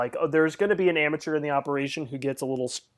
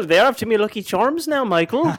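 put-on leprechaun Irish accent; 6 semitones higher, 3 dB louder than usual. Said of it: "talking like a leprechaun".

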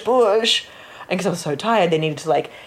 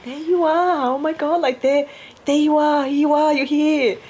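tender greeting; 10 semitones higher than usual. Retold or imitated. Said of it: "I think I was saying".

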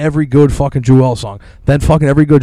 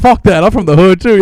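higher, louder defiant yell; mock defiant yell; 6 semitones higher, 5 dB louder than usual. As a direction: yell it like a proud street kid. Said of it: "he was like".

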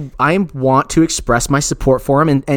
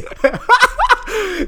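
high giggling laugh; high squeal laugh. Real, not acted. Laughing at own story.

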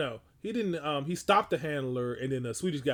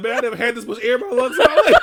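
loud, higher, laughing through it; laughing mock quote; 7 semitones higher, 12 dB louder than usual. Allison after mouth-to-mouth, laughing higher and louder. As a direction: quote her while cracking up. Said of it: "She was like".

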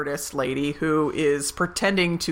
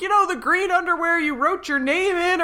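high, mildly raised mock yell; 12 semitones higher than usual. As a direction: call it up from the sidewalk, much higher.

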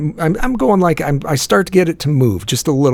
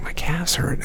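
a soft whisper, much quieter; whispered complaint; 5 semitones higher, 8 dB quieter, slower than usual. Retold or imitated. Complaining to himself about sore calves, whispered. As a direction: murmur it like a pained aside.

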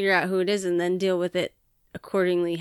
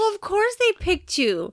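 high, sarcastic teacher voice; snide teacher; 10 semitones higher than usual. Pretend. Wife imagining the teacher, high sarcastic jab. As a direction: sneer it like the teacher who blamed him. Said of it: "I'm thinking".

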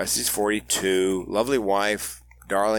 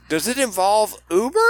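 deadpan driver punchline; 12 semitones higher, 5 dB louder than usual. Retold or imitated.

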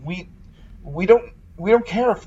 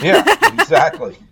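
laughing; 6 dB louder than usual. Real, not acted. Laughing at co-host's joke.

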